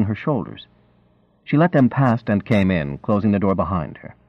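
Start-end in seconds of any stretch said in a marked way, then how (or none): none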